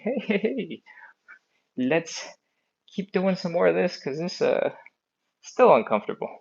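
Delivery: high playful voice for her hamstrings; hamstrings talking. Pretend. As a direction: give the muscles a cheeky little voice. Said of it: "my hamstrings are still telling me".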